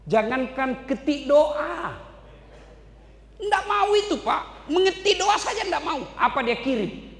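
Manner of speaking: fiery preaching. Acted. Preacher scolding the congregation about friends who won't pray, loud and high. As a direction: preach it hard at the crowd.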